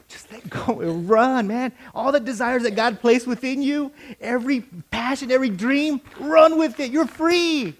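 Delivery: building to a high, loud cry; rousing urging. Acted. Freer urging a doubter to run, rousing and rising. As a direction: urge them on, climbing to full voice.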